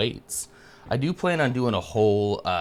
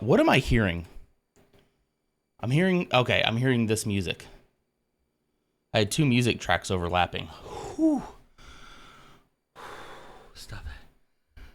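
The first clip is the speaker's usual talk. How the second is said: ordinary talk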